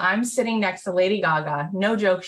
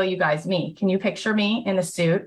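normal talking voice

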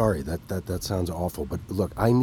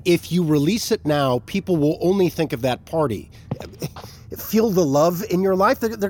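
urgent pleading; 7 semitones higher, 5 dB louder than usual. Acted. Heated argument with boss.

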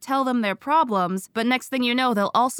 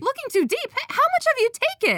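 high, fast accusing disbelief; suddenly suspicious, voice shooting up; incredulous; 14 semitones higher than usual. Real, not acted. Co-host accuses host of stealing.

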